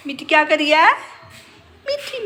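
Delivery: loud, high call to the pet parrot. Real, not acted. Mother calling her parrot Mitthu from across the yard, loud high call. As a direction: shout its name up high and loud.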